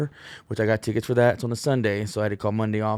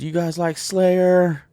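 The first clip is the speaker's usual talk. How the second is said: high, slow rock-star call; imitating guitarist; 8 semitones higher, 6 dB louder, much slower than usual. [name] asks the crowd about Slayer, slow and high. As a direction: draw it out like a guitarist teasing the crowd. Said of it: "he goes".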